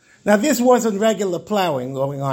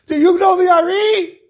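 high, louder desperate question; tearful plea; 9 semitones higher, 5 dB louder than usual. Retold or imitated. Crying souls ask the traveller about the Ari, high imploring cry. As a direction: call it out urgently, imploring him. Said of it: "they started crying".

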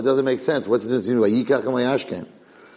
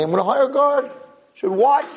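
worried owner voice; 3 dB louder than usual. Acted. Horse owner voiced in joke.

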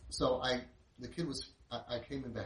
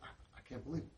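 hushed, overwhelmed disbelief; freaking out; 7 dB quieter than usual. Retold or imitated. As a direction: softly act out a boy who can't believe it. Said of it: "He's freaking out".

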